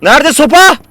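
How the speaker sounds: loud, high-pitched yell; startlingly loud shouted demand; shouting. Real, not acted. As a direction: yell the question out, voice jumping up. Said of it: "neden bağırıyorsun?".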